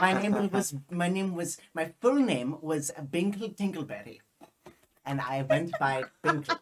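put-on comic German accent. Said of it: "a full German accent".

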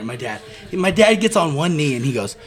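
ordinary delivery

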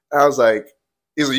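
ordinary delivery

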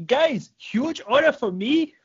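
high, slow Brazilian-accent impression; Bernardo Faria. Podcaster mimicking a jiu-jitsu star, in a high Brazilian accent. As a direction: stretch each phrase, pitched well up, in a Brazilian accent. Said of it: "I can kinda do a Bernardo Faria impression".

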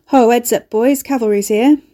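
loud mock welcome, sneering; dripping sarcasm. A narrator's reading. Ricky greeting the arriving rescuers with dripping sarcasm. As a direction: announce the rescuers like a sarcastic herald. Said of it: "with dripping sarcasm".

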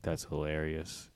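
low, slow, soft deadpan echo; mock a friend's emotionless delivery; emotionless mimicry. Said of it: "says with no emotion on his face".

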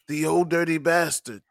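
kung fu movie sage, each phrase set apart. Acted. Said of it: "I had the rizza cadence down".

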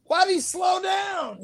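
mock outrage. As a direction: cry out at the screen in disbelief. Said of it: "I was like".

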